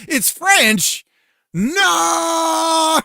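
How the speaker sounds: high, loud wail of despair; a hammed-up howl of horror; mock anguished cry. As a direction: cry out No like your heart broke. Mock anguish over French.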